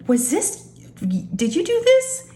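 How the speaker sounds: high, disbelieving question; halting start, then a high surprised squeal of a question; amazed question. Retold or imitated. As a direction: ask in astonishment, pitch leaping up. Acted surprise, asking the son.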